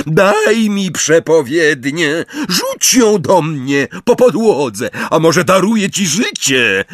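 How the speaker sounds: high, slow commanding cry; shouted demand. A narrator's reading. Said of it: "krzyknęła Bellatrix".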